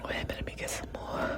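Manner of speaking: whispered aside, barely voiced; sneaky whispered aside. Real, not acted. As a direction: hush it like a secret. Aside while sipping water.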